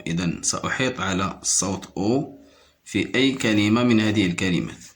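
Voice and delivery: usual speaking voice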